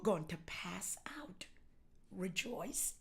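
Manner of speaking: hushed, slow whisper to the church; whispered promise. Acted. Pastor whispering to the congregation about fainting. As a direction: lean in and whisper it.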